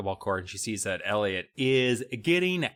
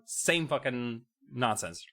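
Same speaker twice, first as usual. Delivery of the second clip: ordinary delivery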